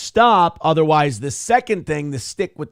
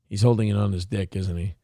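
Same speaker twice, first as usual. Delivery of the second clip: muttered confirmation; 8 semitones lower, 5 dB quieter, faster than usual. Real, not acted. Streamer muttering to chat after spotting Hassan, lower softer aside. Confirming his own suspicion.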